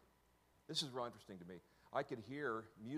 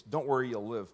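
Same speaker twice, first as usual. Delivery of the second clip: much louder, ringing declaration; loud proclaim; 14 dB louder than usual. Retold or imitated. Preaching the heard words to the crowd, loud. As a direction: proclaim it with full force. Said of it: "repeating the heard words".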